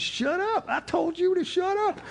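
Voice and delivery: put-on very high, strained old-timer voice; mock an old man's piercing rebuke; confrontational old timer. Old timer in the biker's face, high angry rebuke. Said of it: "He gets in his face".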